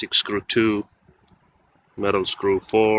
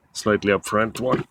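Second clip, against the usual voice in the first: usual speaking voice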